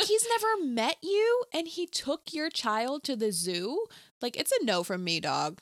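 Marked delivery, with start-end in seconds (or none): 0.0-3.9 high, slow-paced disbelief; incredulous. Retold or imitated. Podcast host aghast at a stranger's zoo trip, incredulous. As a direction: stretch it out, aghast at the very idea. Reacting in disbelief to film scene.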